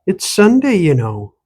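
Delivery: sharp high Irish-tinged rebuke; old woman irritably reminds the stranger it's Sunday; irritable. Acted. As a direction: snap it at him, irritated. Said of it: "she said irritably".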